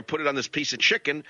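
normal talking voice

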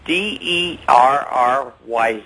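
spelling it letter by letter, slowly; spelled out. Shown as a demonstration. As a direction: spell out D E R R Y with each letter separate. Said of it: "But the real name is Derry City".

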